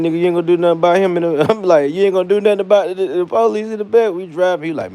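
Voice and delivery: ordinary talk, nothing marked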